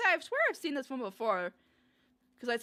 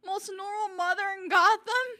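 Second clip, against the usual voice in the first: much higher and louder, cracking with laughter; shocked laughing disbelief; 9 semitones higher, 10 dB louder than usual. Real, not acted. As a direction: blurt it out in stunned amusement. Shocked by the clip's twist.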